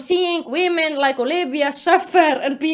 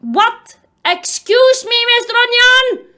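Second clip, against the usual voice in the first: loud, high indignant call-out; outraged disbelief; 7 semitones higher, 6 dB louder than usual. Real, not acted. Reader confronting Mister Onion over his writing, outraged. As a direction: demand an explanation, pitched up and loud.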